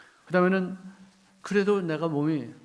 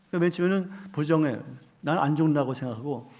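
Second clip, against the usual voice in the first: ordinary talk, nothing marked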